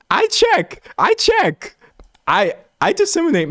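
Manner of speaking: very high I check, I check; squealed protest. Real, not acted. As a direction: shriek it up high in self-defence. Defending against misinformation jab.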